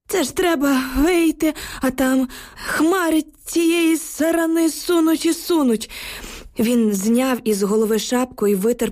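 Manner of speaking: an old neighbour gasping out bad news; frightened old villager. A narrator's reading. Old neighbour tells the women about the bridge, anxious. Old man Yukhym voiced.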